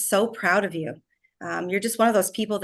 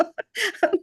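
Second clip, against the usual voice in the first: light laugh; 13 semitones higher than usual. Real, not acted. Laughs after emotional remark.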